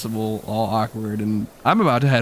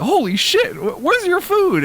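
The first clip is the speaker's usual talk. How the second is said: put-on high shocked kid voice. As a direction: gasp it out in disbelief. Kids shocked.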